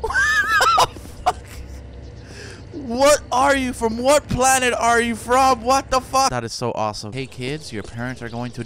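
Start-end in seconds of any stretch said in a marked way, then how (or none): 0.0-6.3 high loud incredulous cries; shocked shrieking. Real, not acted. Player meeting a strange creature, high shocked shouts. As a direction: scream in amused shock.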